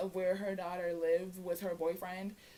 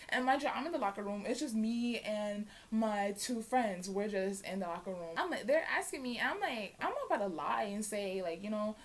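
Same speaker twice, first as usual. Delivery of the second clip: ordinary delivery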